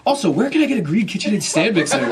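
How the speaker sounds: loud, high, indignant rant; hammed-up angry customer; furious customer. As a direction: act out an outraged complainer. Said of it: "he was furious".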